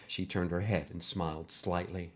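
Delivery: usual speaking voice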